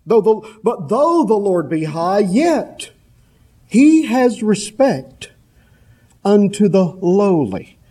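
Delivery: rising to a slow, stressed he has respect; preacher builds the verse to its point; building emphasis. Shown as a demonstration. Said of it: "the reference to lowliness in that verse".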